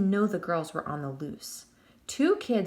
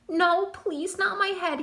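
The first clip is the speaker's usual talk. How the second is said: much higher, sobbing plea; begging; 11 semitones higher, 4 dB louder than usual. A narrator's reading. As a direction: beg for your life. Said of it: "he begged".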